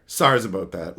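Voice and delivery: low, deep goofy apology; mock apology. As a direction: drop the voice for the joke sorry.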